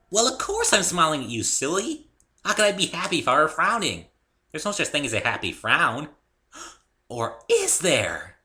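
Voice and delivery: high, loud cheerful chatter; perky pony voice. A narrator's reading. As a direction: bubble over like a happy pony. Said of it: "very perky".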